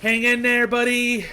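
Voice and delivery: high, loud encouraging call. Acted. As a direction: shout it out to a buddy. Calling out to fallen friend.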